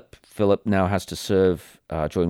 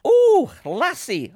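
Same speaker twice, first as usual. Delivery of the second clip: put-on Scottish accent; 6 dB louder, much slower than usual. Praising in a mock Scots voice.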